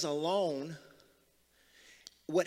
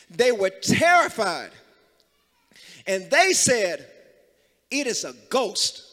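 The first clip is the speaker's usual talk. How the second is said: loud, raised preacher's proclamation; full-force preaching; 5 semitones higher, 5 dB louder than usual. Real, not acted. Preacher proclaiming Jesus' word to the congregation, full-force preaching. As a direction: proclaim it to the whole room, punching key words.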